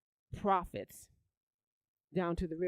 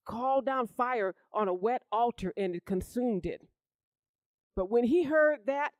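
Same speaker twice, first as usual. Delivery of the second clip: higher, louder preaching build-up; rising preaching; 5 semitones higher, 5 dB louder than usual. Acted. Preacher telling congregation how Elijah fled, rising fervent preaching. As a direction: swell the preaching as the story climaxes.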